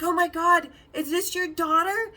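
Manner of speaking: high, loud, delighted gush; sweet gushing. Retold or imitated. Sales girl admiring customer's supposed daughter, sweet gushing. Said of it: "she was so sweet".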